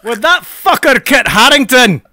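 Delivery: put-on Scottish accent. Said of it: "Kai's Scottish accent".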